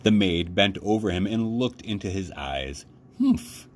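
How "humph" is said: sharp high-pitched humph; a short, scornful humph. A narrator's reading. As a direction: huff it out high and dismissive. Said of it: "she said".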